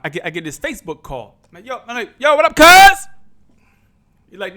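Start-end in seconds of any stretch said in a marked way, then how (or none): 1.7-3.1 loud, high, excited hello to a cousin; loud, high "what up, cuz" greeting; hyped greeting. Retold or imitated. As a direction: greet your cousin like it's been forever. Friendly greeting to his cousin.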